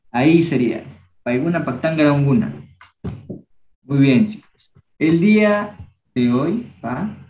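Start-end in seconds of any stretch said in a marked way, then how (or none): 1.2-2.5 Paiguna Paktanga Rauguna said briskly at full pace; brisk normal-speed repeat; quick run-through. Shown as a demonstration. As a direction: rattle it off once they have it. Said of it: "repeats at normal speed".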